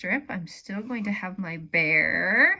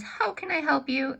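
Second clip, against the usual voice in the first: higher helpful bear voice; voicing the bear; 7 semitones higher than usual. Bear asks the old bird how to help, higher voice. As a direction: offer help kindly, pitched up. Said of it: "So the bear says".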